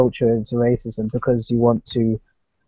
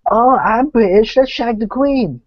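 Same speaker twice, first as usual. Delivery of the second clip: put-on slow, higher, louder British accent; 8 semitones higher, 5 dB louder, slower than usual. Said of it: "the consensus of a British person is like".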